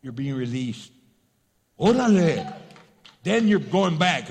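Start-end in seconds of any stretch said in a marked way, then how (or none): 1.8-2.5 a loud pleased shout of órale; excited cheer. Retold or imitated. An inmate hearing he's released, loud and glad. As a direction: whoop it out like a freed man.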